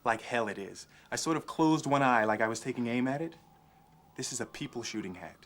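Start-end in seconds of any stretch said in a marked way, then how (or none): 4.2-5.4 low, soft and chilling; hushed, menacing quote; quiet menace. Acted. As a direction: murmur it like a private threat. Quoting Holden's menacing lines.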